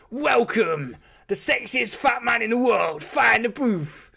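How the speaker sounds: a hint of a hyped DJ welcome; Charlie Sloth impression. Host introducing a guest, lightly done radio DJ voice. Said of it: "if I was doing Charlie Sloth".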